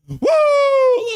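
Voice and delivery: piercingly high, loud woo; ecstatic whoop. Real, not acted. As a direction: let a huge victory whoop rip. Celebrates the title win.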